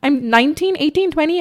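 stunned high-pitched delight; excited disbelief. Retold or imitated. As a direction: relive the thrill of first money. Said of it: "I remember that thought in my head".

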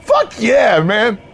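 high, hollered bro cheer; hyped-up cheer. Acted. Manager trades cheers with new hire, hyped-up. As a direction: top the other guy's cheer. Trading cheers over pay.

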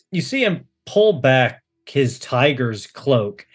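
ordinary talk, nothing marked

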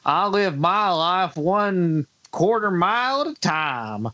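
slow, drawled Alabama accent impression; mocking an actor's Alabama accent line. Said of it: "still with that terrible Alabama accent".

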